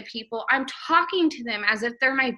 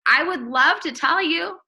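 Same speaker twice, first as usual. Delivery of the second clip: eager reply; 5 semitones higher than usual. Shown as a demonstration. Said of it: "someone's like".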